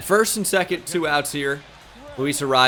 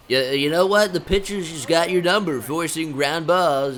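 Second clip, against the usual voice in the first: mocking naysayer; 2 semitones higher than usual. Mimics the dismissive players once more.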